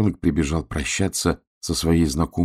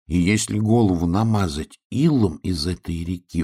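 plain reading voice

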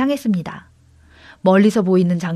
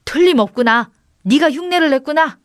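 higher, mildly excited realisation; lightly raised wonder; amazed certainty; 5 semitones higher than usual. Acted. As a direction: exclaim that you have found the culprit. Brother confirms the goat mimicked him.

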